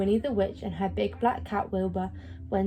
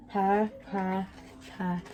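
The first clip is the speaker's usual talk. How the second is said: cat purr; 2 semitones lower, 6 dB quieter, slower than usual. Acted. Cat purring in lap.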